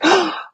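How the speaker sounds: mock gasp. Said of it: "And she goes".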